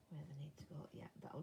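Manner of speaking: whisper. Real, not acted. Quiet remarks to herself while sewing.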